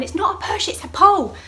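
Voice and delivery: high, loud retold shout; exasperated correction. Danny to midwife at alarm button, high exasperated shout. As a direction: call out the correction urgently.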